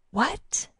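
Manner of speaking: breathy startled "what"; shocked whisper. A narrator's reading.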